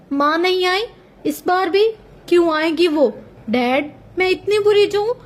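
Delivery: a small daughter asking why her mother never comes; innocent little girl. A narrator's reading. Daughter asking her father, innocent and hurt. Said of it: "मासूमियत से बोली थी".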